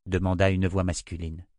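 usual speaking voice

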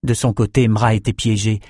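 ordinary delivery

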